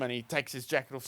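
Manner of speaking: ordinary delivery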